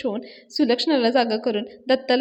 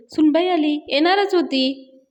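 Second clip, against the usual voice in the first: taunting shout; 6 semitones higher, 4 dB louder, slower than usual. Acted. Anna taunting neighbours loudly.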